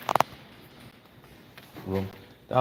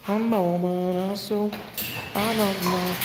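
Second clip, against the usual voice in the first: put-on baby talk; 12 semitones higher, slower than usual. High baby-talk to dogs.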